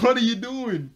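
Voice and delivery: high, loud exaggerated demand; mock outraged yell. Beast Boy outside Raven's door, mock outraged yell. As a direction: shout it at someone behind a door.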